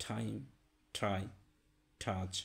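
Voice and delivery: টাইম ট্রাই টাচ with a puffed ঠ-like t; breathy t on টাইম; hard aspirated t in টাচ. Shown as a demonstration. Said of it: "টি এর উচ্চারণ ঠি".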